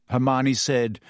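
ordinary delivery, nothing marked

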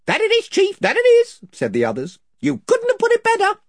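a squeaky crowd of toadies egging him on; chorus of fawning followers. A narrator's reading. Other voices agree.

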